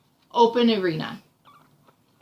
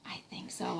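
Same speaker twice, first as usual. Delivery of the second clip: soft, breathy whisper aside; hushed agreement; 17 dB quieter than usual. Real, not acted.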